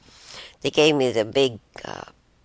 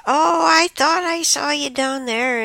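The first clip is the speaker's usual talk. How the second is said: put-on much higher, louder old-lady voice; confused old lady; 13 semitones higher, 5 dB louder than usual. Deaf roommate insists she saw her downstairs, high mock old-lady voice. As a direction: play the bewildered roommate insisting. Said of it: "So she said".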